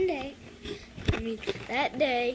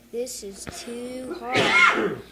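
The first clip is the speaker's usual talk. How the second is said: slow, loud complaint; loud, drawn-out complaint about the chair; 9 dB louder, much slower than usual. Acted. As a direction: drag it out loudly. Goldilocks tries the chair.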